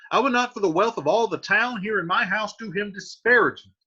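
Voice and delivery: the host laying down the law; firm Capulet. Acted. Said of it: "Capulet says".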